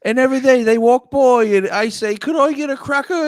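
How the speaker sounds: high, loud put-on British orphan; hammed-up English urchin telling his woes; pitiful British child. The boy tells of daily abuse, mock pitiful British child.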